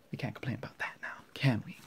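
fast hushed whisper; quiet whisper. Real, not acted. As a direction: whisper it so the family won't hear. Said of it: "I'm very sorry if I'm talking really quietly".

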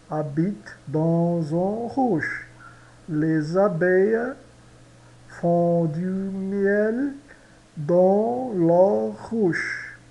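devagar, sílaba por sílaba; slow French model. Shown as a demonstration. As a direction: leia a frase em câmera lenta. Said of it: "vamos estudar repetindo". (leitura lenta para os alunos repetirem)

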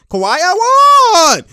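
high, loud, drawn-out holler; slow, soaring celebratory shout; excited shout. Real, not acted. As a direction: stretch the call into a yell. Calling out a basket.

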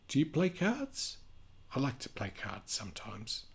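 lively storybook reading voice; sing-song read-aloud for kids; reading to children. A narrator's reading. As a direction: read it out like a picture book for little ones. Reading picture book aloud.

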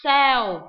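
loud clear model of "Sail"; teacher demo of "Sail"; model word. Shown as a demonstration. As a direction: say "Sail" crisply for students. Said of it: "cái này là gì nào?".

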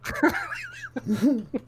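light high laugh; real laugh at the picture. Real, not acted. Laughing at the image.

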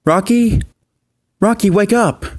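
put-on boy calling a pup out of a nightmare; calling to wake. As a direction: rouse a dreaming friend. Ryder wakes Rocky from nightmare.

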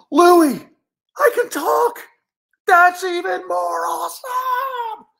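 very high, loud squeal; ecstatic excitement. Acted. Bear discovering he can talk, ecstatic squealing. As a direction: shout it, stretching the end in delight.

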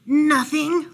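high, loud denial; lying, too quick. A narrator's reading. Bear hiding fright from Mouse, high loud denial. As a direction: deny it loudly, pitch jumping up. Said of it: "lied Bear".

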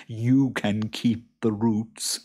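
slow creature voice ending in a chuckle; self-satisfied boggart; chuckling boggart. A narrator's reading. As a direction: draw it out, then chuckle. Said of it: "chuckled the boggart".

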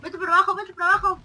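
loud, high calling out; urgent shout. Real, not acted. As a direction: shout directions at a teammate. Urging teammate in boss fight. (gritando instrucciones)

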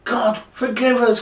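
high, loud cry of dismay; horrified cry. Acted. Sailors beg God's forgiveness, horrified cry. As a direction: cry out in acted horror. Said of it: "cried the sailors".

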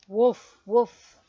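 loud storybook woofs; startled dog bark. A narrator's reading. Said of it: "said the startled dog".

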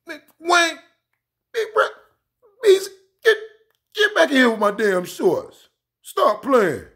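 high, loud mock coach yelling; hammed-up coach hollering at players; squeaky high coach scolding the team; imitating coach. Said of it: "and Coach Brown was".

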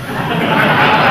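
short amused chuckle; real little laugh; light laugh. Real, not acted. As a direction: chuckle at your own joke. Laughs at own joke.

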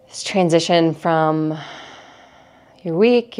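calm, measured meditation guide with long pauses; guided meditation. Acted. Teacher leads listeners through breathing in an energy cleanse, calm guided meditation. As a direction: guide them gently through each breath, unhurried.

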